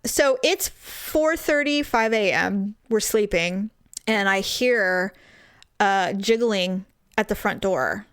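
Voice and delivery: ordinary delivery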